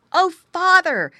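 put-on high, loud boyish appeal; excited exclamation. A narrator's reading. Boy appealing to father, exclaiming. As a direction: call out eagerly to a parent. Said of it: "exclaimed the boy".

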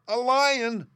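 high, loud squeaky alarm; frightened cry. A narrator's reading. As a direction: cry out like a startled mouse. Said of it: "cried the little Queen".

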